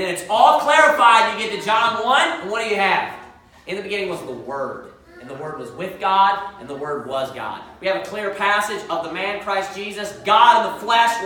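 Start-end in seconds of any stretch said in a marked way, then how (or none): 3.7-7.6 low, hushed verse recitation; scripture recitation. Acted. Preacher answering with John one one, low solemn recitation. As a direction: lower and soften it, phrase by phrase.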